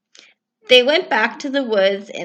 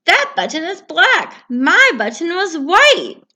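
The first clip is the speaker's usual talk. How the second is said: higher, louder, slow at the end; mildly cross Toad; fussy Toad; 9 semitones higher, 7 dB louder than usual. Acted. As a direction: correct Frog, a bit fussy.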